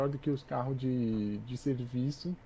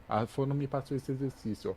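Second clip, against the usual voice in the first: usual speaking voice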